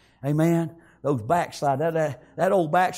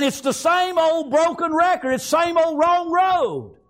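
high, driving preacher's delivery; preaching; 7 semitones higher, 4 dB louder than usual. Real, not acted. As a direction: hammer it home from the pulpit, pitched high. Preaches at full force.

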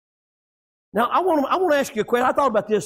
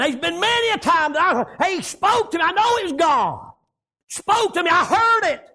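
high, driving country sermon delivery; raised, ringing pulpit voice; full-force preaching; 10 semitones higher than usual. Real, not acted. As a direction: preach it out hard, drawing out the line ends.

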